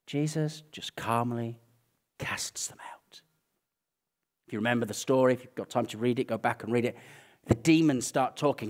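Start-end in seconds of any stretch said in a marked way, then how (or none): 0.1-3.2 slow, soft, fading into a whisper; calm hush. Shown as a demonstration. Preacher showing Jesus casting demons out, calm hush. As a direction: say it gently, sinking to a whisper.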